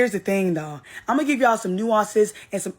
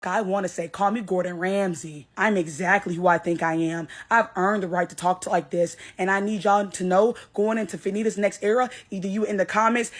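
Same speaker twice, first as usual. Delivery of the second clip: ordinary talk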